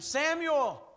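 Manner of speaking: high, loud call of a name. Retold or imitated. God's voice calling the sleeping boy Samuel, loud call. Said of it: "a voice called out to him".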